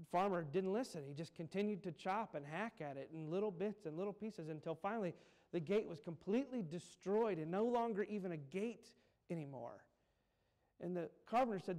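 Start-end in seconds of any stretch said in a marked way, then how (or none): none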